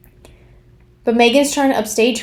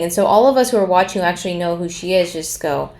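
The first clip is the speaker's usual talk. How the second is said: ordinary delivery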